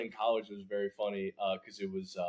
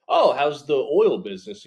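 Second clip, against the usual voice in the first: put-on louder, chummy old-timey guy; mock 1950s businessman; 3 semitones higher, 10 dB louder than usual. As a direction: play a jolly old businessman. Mock oilman quote.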